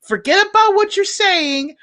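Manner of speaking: high incredulous laughing cry; laughing exasperated shout. Retold or imitated. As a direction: blurt it out through laughter. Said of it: "And it's like".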